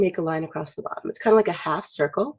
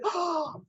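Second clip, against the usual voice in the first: high, soft gasped "oh"; acted sharp intake of shock; 12 semitones higher, 8 dB quieter than usual. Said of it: "or you're, you're shocked".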